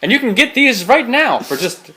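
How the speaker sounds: upbeat TV sales pitch; infomercial voice. Pretend. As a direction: sell it like a late night ad. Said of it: "like we're promoting".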